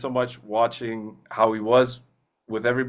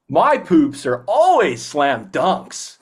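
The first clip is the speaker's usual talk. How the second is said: slow, loud, high-timbred cartoon voice; Wayne's voice; 3 semitones higher, 6 dB louder, much slower than usual. Actor performs his own cartoon line for the host, slow and loud. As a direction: stretch each word out proudly.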